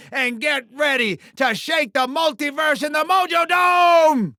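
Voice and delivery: high showman's shout, swelling to the end; hyped-up call that climbs into a held bellow; arena rallying cry. Acted. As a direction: whip up the crowd with a big announcer yell. Rallying fighter before the show.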